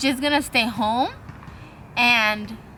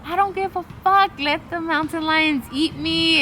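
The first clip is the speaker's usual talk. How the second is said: mock despairing yell; 6 semitones higher than usual. Fed up on the run, yelling at her trainer, high despairing outburst. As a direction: throw it out like you've given up. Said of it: "saying all these things".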